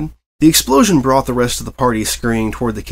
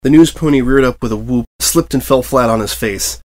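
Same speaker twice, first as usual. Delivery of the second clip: ordinary delivery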